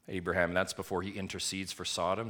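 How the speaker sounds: normal talking voice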